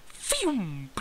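slow breathy whoosh of a bullet; whooshing bullet sound. Retold or imitated. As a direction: blow it out like a bullet flying past. Bullet noise in story.

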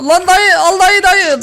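high, loud yell at the angry uncle; yelling at customer. Real, not acted. Yelling at the fuming uncle to take his order. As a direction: shout to hurry him out.

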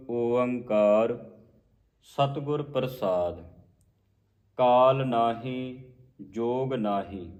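slow, drawn-out vowels; measured scripture recitation. Shown as a demonstration. Teacher modelling the shlok for the congregation, slow measured recitation. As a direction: recite word by word, stretching each.

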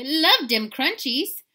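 high, loud, drawn-out relish; excited exclamation. A narrator's reading. Kitty relishes his fly snack, high excitement. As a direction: savour it loudly, stretching the words. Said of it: "exclaimed the kitty boy".

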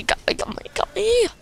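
whispered mock dying gasp. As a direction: fade out like a dying soldier. Dying in game, mock death.

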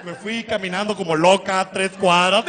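usual speaking voice

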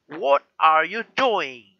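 drawn-out, loud imitation of British English; British accent. Shown as a demonstration. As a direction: exaggerate a slow British accent. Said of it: "But the accent always like".

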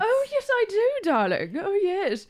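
high, swooping mock British accent. Agreeing she likes British accents, British accent. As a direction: gush in an exaggerated British accent. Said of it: "you like British accents".

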